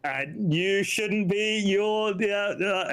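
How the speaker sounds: high, slow, hammed-up drunk mumble; mock drinker voice. Imitating drinkers offering excuses.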